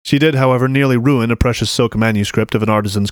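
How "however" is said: usual speaking voice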